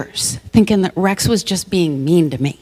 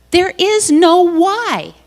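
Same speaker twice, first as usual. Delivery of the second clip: "There is no why" much higher and slow, amazed; excited realization; 9 semitones higher, much slower than usual. Retold or imitated. Finally getting her partner's point, "There is no why" high and amazed. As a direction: savour "There is no why" as a discovery.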